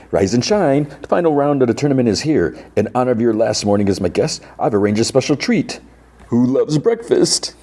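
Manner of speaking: high, showy host's announcement; PA announcer. Retold or imitated. Host wakes his guests for the final round, showy announcer. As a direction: call it out to all the guests over the PA. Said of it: "then he goes to P A".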